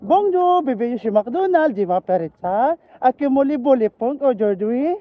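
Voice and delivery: put-on French cashier lines in a light Ilonggo accent. Trying cashier French the Ilonggo way, Ilonggo-accented French. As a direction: read the French greeting with Ilonggo sounds. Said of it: "Ilonggo version".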